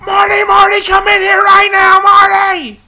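high, loud, urgent yell for someone; alarmed shout. Retold or imitated. Wife calling husband from the bedroom, alarmed shout. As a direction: shout the name in alarm from another room. Wife's sudden alarmed call retold.

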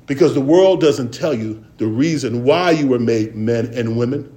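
ordinary talk, nothing marked

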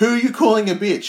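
high, loud mock indignation; hammed-up offended shout; mock outrage. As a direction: throw it out loud and high, playing affronted. Mock-voicing outraged wrestler.

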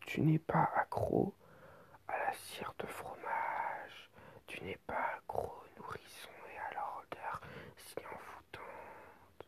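hushed unvoiced pep talk to himself; breathy whispered self-restraint. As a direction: whisper it like a secret plea to yourself. Self-talk whispering to resist addiction.

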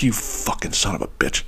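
under-the-breath whispered curse; muttered curse. Retold or imitated. Cursing the fruit flies in a whisper. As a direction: hiss it so nobody hears.

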